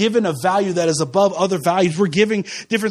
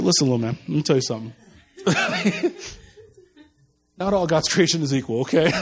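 stern dad; 6 semitones lower, 3 dB quieter, faster than usual. Retold or imitated. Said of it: "I said".

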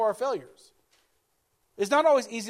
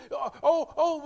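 normal talking voice

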